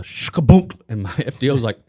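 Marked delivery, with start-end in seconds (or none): 0.0-0.8 sudden punchy "shkaboonk" thud noise; vocal sound effect of a clunk; imitated clunk. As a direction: mimic something tumbling off a car, higher pitched. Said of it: "I hear the, uh".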